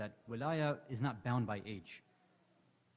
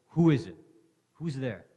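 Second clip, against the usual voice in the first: fearful asking; 9 dB louder than usual. Acted. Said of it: "with fear in his voice".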